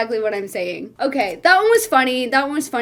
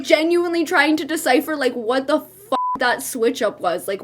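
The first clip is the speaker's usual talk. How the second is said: ordinary delivery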